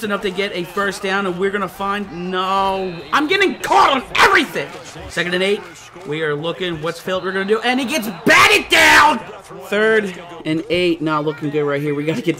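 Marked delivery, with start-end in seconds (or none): 3.1-4.7 higher, louder annoyed shout; frustrated shout; frustrated yell. Real, not acted. Frustrated gamer yelling. 7.6-9.2 higher, louder dismayed call; annoyed play-call shout; frustrated shout. Real, not acted. Frustrated at a failed pass.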